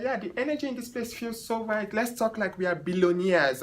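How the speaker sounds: a hint of the posh client dreaming big. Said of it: "she was like".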